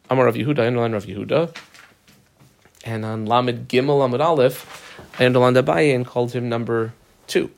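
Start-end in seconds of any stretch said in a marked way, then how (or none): none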